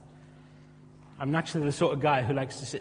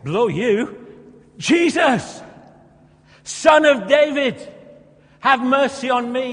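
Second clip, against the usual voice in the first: high, loud defiant shout; shouting louder; 8 semitones higher, 8 dB louder, slower than usual. Acted. Beggar defying the crowd, shouting louder to Jesus. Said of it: "Spurs him on all the more".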